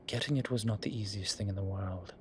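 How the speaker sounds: normal talking voice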